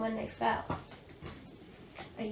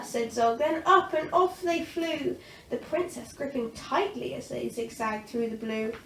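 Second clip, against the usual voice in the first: usual speaking voice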